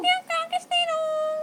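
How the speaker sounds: high, slow sing-song coaxing. Real, not acted. (mataas at malambing na paglalambing)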